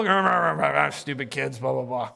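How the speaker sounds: low, mumbled mock grumble; grumbling. Convicted elder walking away from kids, grumbling. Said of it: "You can grumble".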